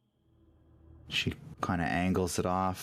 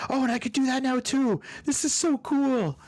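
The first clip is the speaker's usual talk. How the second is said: very high, fast, thrilled character voice; excited Lulu; 4 dB louder than usual. Acted. Lulu has just blasted her trumpet, squealing excitedly. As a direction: squeal with delight at a new power. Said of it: "And she goes".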